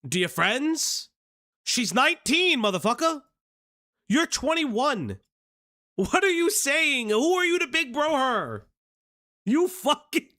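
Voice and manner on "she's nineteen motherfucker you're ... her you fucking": raised ranting voice. Real, not acted. Streamer rants at the guy.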